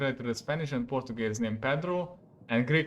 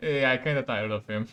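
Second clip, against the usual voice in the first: ordinary talk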